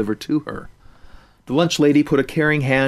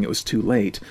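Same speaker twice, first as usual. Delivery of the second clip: ordinary delivery, nothing marked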